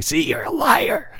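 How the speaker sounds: high taunting accusation; voice of shame. Retold or imitated. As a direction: sneer like a cruel inner voice. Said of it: "that voice in my head of shame or condemnation".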